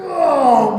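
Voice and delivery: loud, high drawn-out whoa; long swooping cry; whooping whoa. Acted. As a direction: whoop as the plane lurches off. Imitating the plane lurching off.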